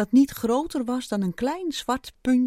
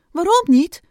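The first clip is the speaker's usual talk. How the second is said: loud, raised-pitch shout; calling back; 8 semitones higher, 11 dB louder than usual. A narrator's reading. Squirrel calling back to the ant underground, shouting. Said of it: "riep de eekhoorn terug".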